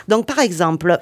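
normal talking voice

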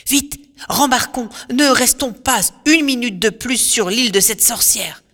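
a frightened sailor begging to flee; pleading in fear. Acted. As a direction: Euryloque begging the crew to leave the witch's island. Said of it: "supplie Euryloque".

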